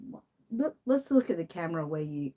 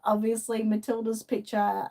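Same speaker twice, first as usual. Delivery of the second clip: ordinary delivery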